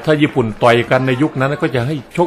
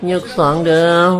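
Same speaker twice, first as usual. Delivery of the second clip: slow, higher-pitched round call; drawn-out announcement; 4 semitones higher, much slower than usual. Acted. Commentator calling round two to viewers, drawn-out announcement. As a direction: stretch the words out high.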